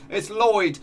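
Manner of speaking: loud, high goal call; first loud cry of the goal, before the peak; excited call. Real, not acted. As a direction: call out the scorer with delight. Goal scored by star striker.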